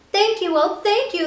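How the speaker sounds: high, loud heartfelt thanks; grateful cry. Acted. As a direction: gush with relief. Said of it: "the grateful witch cried".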